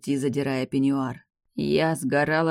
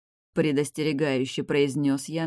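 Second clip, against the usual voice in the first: ordinary delivery, nothing marked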